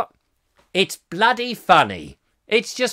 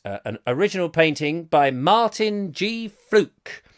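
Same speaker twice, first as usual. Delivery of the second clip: ordinary talk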